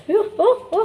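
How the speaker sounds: high laughing oh-oh; a delighted little shriek; laughing surprise. Real, not acted. Mother laughing as the puppy runs off, surprised.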